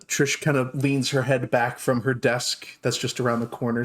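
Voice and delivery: normal talking voice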